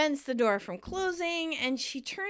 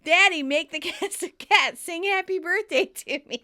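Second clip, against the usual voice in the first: loud, higher whiny mockery of a rich girl; building super mocking Rachel as a princess; mocking spoiled princess; 5 semitones higher, 5 dB louder than usual. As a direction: sneer at her like a spoiled child begging daddy. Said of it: "'cause you're a little princess".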